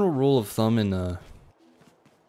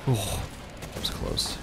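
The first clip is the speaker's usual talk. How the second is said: whispered relief; 8 dB quieter than usual. Real, not acted. Near miss in game.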